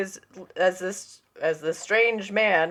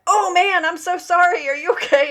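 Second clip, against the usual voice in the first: high, louder panicked sorry; flustered apology; 11 semitones higher, 4 dB louder than usual. Retold or imitated. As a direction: gush an apology in a rush. Apologizing to [name].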